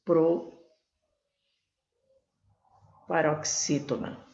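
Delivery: Proparoxítona split, PRO then a long wait; suspenseful pause. Shown as a demonstration. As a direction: hang after PRO before completing Proparoxítona. Teacher drawing out the answer. (Proparoxítona com pausa longa depois do PRO)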